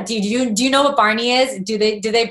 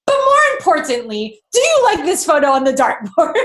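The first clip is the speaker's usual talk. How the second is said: louder, laughing mock of a friend's sudden cheer; mock friend, lightly voiced; 6 semitones higher, 5 dB louder than usual. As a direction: switch to chirpy dartboard talk with a laugh. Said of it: "she's like".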